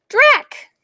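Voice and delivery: ordinary delivery, nothing marked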